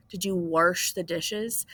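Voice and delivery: "wash" said as "warsh", with an added R; slow question, putting an R into "wash"; dad's "warsh". Retold or imitated. Teacher imitates dad asking about dishes, "wash" as "warsh". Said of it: "my dad says".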